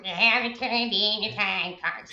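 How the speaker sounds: slow grumpy clerk voice, drawn out; slow mock payroll clerk nag; Roz impression. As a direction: drag each word like a bored office monster. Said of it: "no one wants Roz rolling into their office".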